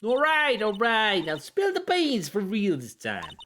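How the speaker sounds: squeaky, excitable puppet witness; high-pitched nervous puppet. Acted. Voices puppet witness in game.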